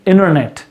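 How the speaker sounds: इंटरनेट said "innernet" with no T; silent T. Shown as a demonstration. Teacher repeats internet with T silent. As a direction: drop the T after the N. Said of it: "इसको क्या बोलेंगे".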